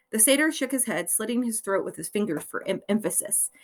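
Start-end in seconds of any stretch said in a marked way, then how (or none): none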